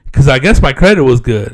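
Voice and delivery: ordinary talk, nothing marked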